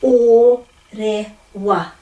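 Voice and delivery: o, re, wa as separate syllables; or, re, wa with pauses between; slow syllable drill; broken at vowels. Shown as a demonstration. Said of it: "breaking up at every vowel".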